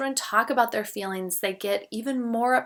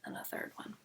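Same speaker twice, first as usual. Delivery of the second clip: soft whisper; 18 dB quieter than usual. Shown as a demonstration. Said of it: "then do the deep breaths".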